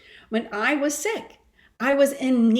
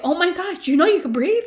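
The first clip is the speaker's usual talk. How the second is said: higher and louder, bursting with the news; excited gush; 8 semitones higher, 5 dB louder than usual. Retold or imitated. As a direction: gush like telling everyone about a great restaurant. Retelling her excited discovery.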